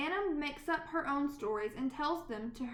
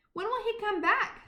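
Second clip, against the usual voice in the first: higher, louder pleading question; child nagging mother, higher; eager child; 7 semitones higher, 5 dB louder than usual. Acted. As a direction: ask like an impatient little girl. Anna pestering again.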